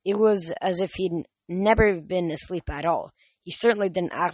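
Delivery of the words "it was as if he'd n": ordinary delivery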